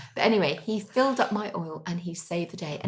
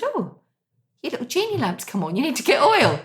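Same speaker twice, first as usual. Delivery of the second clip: farmer warning; 7 semitones higher, 6 dB louder than usual. Retold or imitated.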